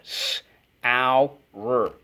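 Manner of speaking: s-ow-r stretched into its sounds; sounded out. Shown as a demonstration. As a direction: pull S-ow-r apart sound by sound. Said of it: "We use ow".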